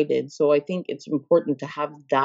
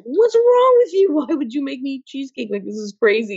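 high-pitched, exaggerated disbelief; retold shriek of disbelief, starting loud and very high; incredulous protest; 10 semitones higher, 3 dB louder than usual. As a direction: squeal it in mock outrage, then come down a little. Retelling her protest to coach's order.

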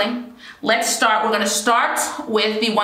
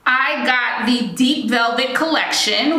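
ordinary talk

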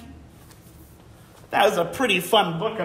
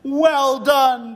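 proud dad; 8 semitones higher, 9 dB louder than usual. Acted. Dad praises Otto.

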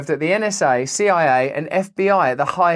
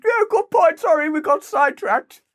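very high, silly British voice; British accent; 12 semitones higher than usual. Acted. Sitcom double admitting they strayed off topic, high British accent. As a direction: apologise as the squeaky British sitcom character. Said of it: "I like that British accent you're doing".

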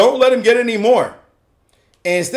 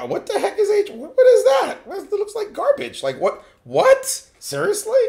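high, incredulous squeak; mock-incredulous sputtering; acted disbelief; 11 semitones higher, 3 dB quieter than usual. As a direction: react baffled, pitch leaping up. Acts out opponent baffled by move.

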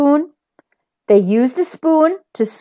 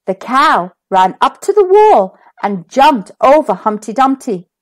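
ordinary delivery

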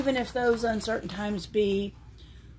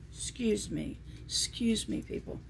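soft, half-whispered excuse me; hushed excuse me; 7 dB quieter than usual. Real, not acted. To someone off camera, a fading hushed excuse me. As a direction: drop to a near whisper, fading away. Hushed aside, not speaking to viewers.

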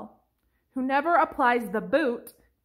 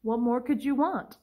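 ordinary talk, nothing marked

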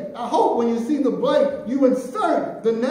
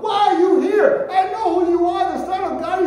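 much higher, louder shrieking demon; demon crying out; 9 semitones higher, 7 dB louder than usual. Acted. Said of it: "comes to him and cries out".

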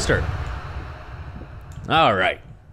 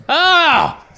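startled; 10 semitones higher, 6 dB louder than usual. Real, not acted. Startled by scare.

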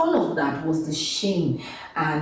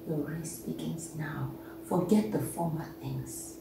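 much softer, low and gentle; soft promise; 4 semitones lower, 10 dB quieter than usual. Acted. God telling the listener to move on, soft. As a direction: tell it quietly like a promise.

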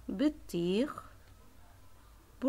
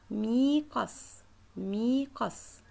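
slow drilling; 2 semitones higher, 3 dB louder, much slower than usual. Shown as a demonstration. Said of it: "teacher drills the word slowly".

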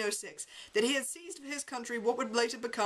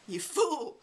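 a scornful snorted retort, pitched higher; 6 semitones higher than usual. A narrator's reading. Narrator scolding his lawyer about Roosevelt, scornful snort. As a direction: scoff at her like she's a fool. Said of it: "I snorted".

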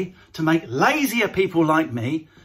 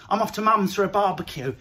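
usual speaking voice

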